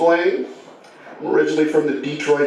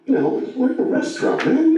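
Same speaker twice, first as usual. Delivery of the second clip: higher-pitched imitation of a dad hushing his kid; acted calming-down plea; 8 semitones higher than usual. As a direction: play a flustered dad reining in his son. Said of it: "trying to calm the kid down".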